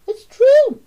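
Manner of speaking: put-on very high, loud boy's cry; cried. A narrator's reading. Boy insists to Mummy, very high. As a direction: insist like a upset child. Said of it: "he cried".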